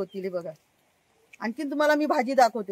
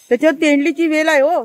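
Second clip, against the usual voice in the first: loud call across the garden; calling out a warning; 3 semitones higher, 10 dB louder than usual. Real, not acted. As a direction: raise your voice to warn him. Warning the man picking flowers. (मोठ्याने हाक मारून सावध करणे)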